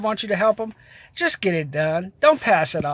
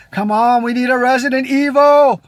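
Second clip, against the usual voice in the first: excited outburst; 4 semitones higher, 6 dB louder than usual. Real, not acted. Excited rant about zombie movie.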